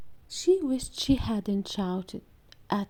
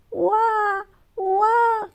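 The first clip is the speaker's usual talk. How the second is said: put-on high loud baby wail; baby crying; 10 semitones higher, 6 dB louder than usual. A narrator's reading. Baby brother crying at the racing car, high wail. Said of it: "cried Mikey".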